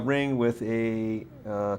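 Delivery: ordinary talk, nothing marked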